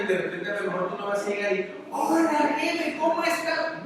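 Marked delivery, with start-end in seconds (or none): 1.9-3.8 high, chummy hello to the boss; mock bubbly greeting to the boss; overly cheerful greeting. Shown as a demonstration. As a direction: breeze in far too friendly. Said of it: "No, empiezas".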